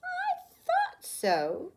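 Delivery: very high, slow knowing tone; said with a smile. A narrator's reading. Old woman knowingly answers Camilla, slow and smiling. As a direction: smile it out slowly. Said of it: "said with a smile".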